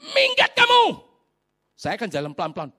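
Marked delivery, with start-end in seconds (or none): 0.1-1.0 furious yell. Retold or imitated. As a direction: scream him out the door. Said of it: "kayak ngusir setan dulu". (teriakan mengusir sangat keras)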